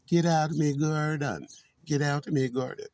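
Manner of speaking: a loud shooing yell; loud shout. Shown as a demonstration. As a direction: yell at the birds to get out. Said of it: "you catch that?".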